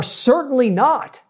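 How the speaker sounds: slow, lifted, firm refusal; emphatic. Shown as a demonstration. Preacher showing Paul's Greek answer, slow and emphatic. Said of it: "used as in a very emphatic tone".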